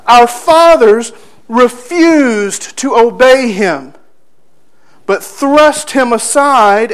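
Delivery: loud, emphatic scripture reading; declaimed scripture. Acted. Preacher reading Moses's story to the congregation, declaimed. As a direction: proclaim the verses, leaning hard on key words.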